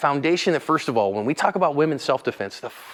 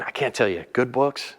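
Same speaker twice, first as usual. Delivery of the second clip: ordinary delivery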